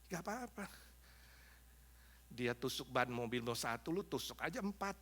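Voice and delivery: soft, sly devil's temptation; devil's whispering. Acted. Devil tempts an angry man to puncture tyres, whispering. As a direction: murmur it low as a tempter in the ear. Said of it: "mulai bisik-bisik di telingamu".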